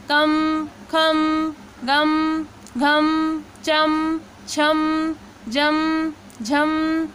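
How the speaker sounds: each syllable कम, खम, गम closing on a clear म, chanted one by one; syllable drill. Shown as a demonstration. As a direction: recite the अम drill steadily, pausing after each. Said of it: "आधे म को जोड़ना है". (अम ध्वनि का अभ्यास, हर अक्षर अलग-अलग साफ़)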